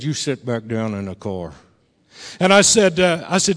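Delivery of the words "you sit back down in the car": low, quiet fatherly order; stern dad. Retold or imitated. Dad orders son back into car, quietly stern. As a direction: imitate Dad's restrained command. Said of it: "and Dad said".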